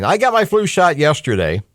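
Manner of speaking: ordinary talk, nothing marked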